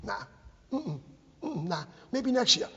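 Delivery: mock shrugging refusal, broken by pauses; dismissive town. Speaker mimics Braintree brushing off fundraising, mock dismissive. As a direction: imitate a town that can't be bothered. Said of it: "Braintree goes".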